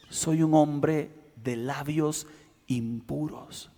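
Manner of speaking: más bajo y suave, lento; confesión en voz baja. Acted. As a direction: confiesa suavemente con humildad. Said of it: "y dijo". (Isaías confiesa quedo su pecado)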